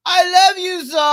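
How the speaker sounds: lightly voiced Sherelle impression, loud; imitating Sherelle. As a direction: belt it out as a mock Sherelle. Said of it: "That's as close as I can get to her voice".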